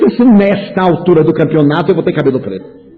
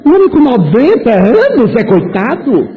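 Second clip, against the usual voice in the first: higher, slow, disbelieving; incredulous question; 8 semitones higher than usual. Retold or imitated. Rebutting those who pity Chico, slow incredulous question. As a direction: draw it out in disbelief.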